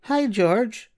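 put-on sweet little girl saying hi; young girl Yvonne. Girl Yvonne greets George.

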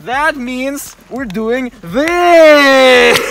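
excited. Real, not acted. Hyped announcement.

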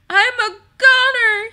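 high, loud hammed-up wail; blubbering. A narrator's reading. Rollo despairs that he is dying, loud high blubbering. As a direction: blubber it loudly, far above your own voice. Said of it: "he blubbered".

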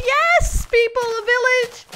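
high, loud squeal of joy; thrilled high-pitched yell; excited shout. Real, not acted. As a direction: cry out in overjoyed excitement. Spotting a village in the game.

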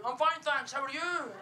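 high, exaggerated Scottish lilt; mimicked Scottish accent. Strangers answering the comedian back, mock Scottish accent. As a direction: parrot a greeting back in an overdone Scottish accent. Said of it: "they speak back to me in a Scottish accent".

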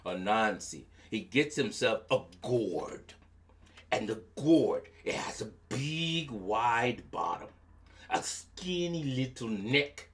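usual speaking voice

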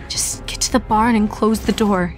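usual speaking voice

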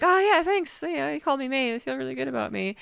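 high, chirpy fake-pleased voice; mock flattered reply. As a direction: preen at being called mate. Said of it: "And he'd be like".

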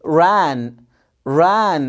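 "ran" with tongue curled back; initial English r. Shown as a demonstration. Teacher showing pupils the r in "ran". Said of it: "نلفه شوية ونقول كلمة".

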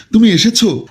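high-pitched startled question; a touch of amazed relief; surprised. Acted. Rescued girl sees her lover arrive.

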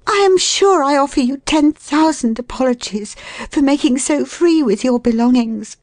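high, effusive woman's apology; apologetic. A narrator's reading. Woman to the man whose bed she used, apologetic. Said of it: "I said apologetically".

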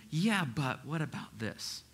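slow, doubting yeah-but; defensive inner voice. Retold or imitated. Pastor voicing the listener's slow skeptical pushback. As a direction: drag out the objection slowly. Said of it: "something inside you that's going".

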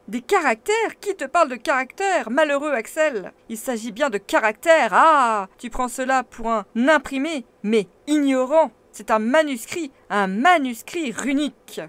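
loud, high exasperated scolding; scolding uncle. A narrator's reading. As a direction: berate the ignorant nephew. Uncle scolds ignorant nephew.

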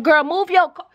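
high, loud, urgent call. Real, not acted. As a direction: holler at her to get moving. Impatient call to neighbor moving car.

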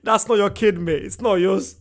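loud, laughing through the words; laughing outburst. Real, not acted. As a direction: crack up and call it out loud. Laughing at the clip's joke.

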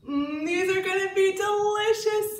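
put-on high, loud eager kids; excited child voice. A narrator's reading. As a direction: say it like hungry children.

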